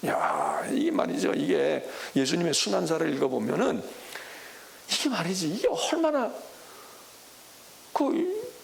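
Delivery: usual speaking voice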